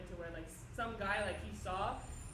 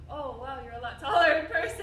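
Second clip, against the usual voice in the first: laughing fan; 5 semitones higher, 14 dB louder than usual. Retold or imitated. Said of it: "they're just like".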